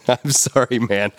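real chuckle breaking into the words; laughing apology. Real, not acted. Breaks up mid-sentence, apologizing.

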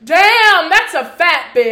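imitating a loud, jeering high catcall, laughing; mock loud catcall. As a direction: bark the insult like the guys in the car. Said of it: "it's not a yell, but it's loud enough".

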